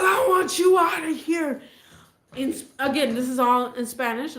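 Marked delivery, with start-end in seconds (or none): 0.0-1.6 loud, high shouted order; retold angry yell; shouting. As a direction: yell him out of the house. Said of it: "He said".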